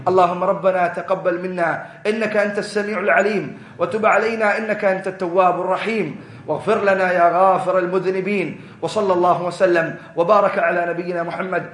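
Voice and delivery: low, slow Arabic supplication; low, slow devotional Arabic prayer; closing prayer. Acted.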